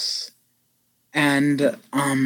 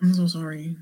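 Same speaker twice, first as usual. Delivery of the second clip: quiet; 9 dB quieter, faster than usual. Real, not acted. Apologizing to aunt.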